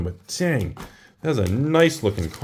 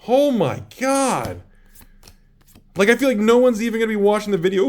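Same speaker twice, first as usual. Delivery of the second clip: excited; 8 semitones higher than usual. Real, not acted. Still buzzing from the pulls.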